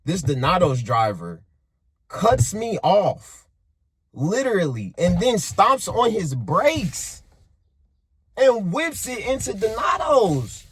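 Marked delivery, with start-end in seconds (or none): 2.1-10.6 riled-up retelling. Retold or imitated. Retelling the driver cutting him off.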